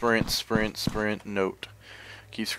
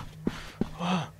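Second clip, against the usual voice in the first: frightened gasp; 9 semitones higher, 5 dB quieter than usual. Real, not acted. Startled gasp in horror game.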